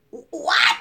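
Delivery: high-pitched shocked "what"; stunned disbelief. Retold or imitated. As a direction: yelp it in mock shock. Acts out shock at stranger's remark.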